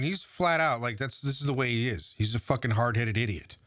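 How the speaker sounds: usual speaking voice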